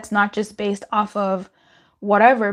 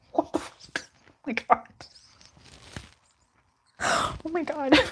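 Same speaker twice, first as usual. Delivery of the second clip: a laughing shriek that rises very high, then a soft gasped "oh my God"; real fright; 5 semitones higher, 7 dB quieter than usual. Real, not acted. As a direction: caught by a sudden scare, half laughing. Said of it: "That scared me".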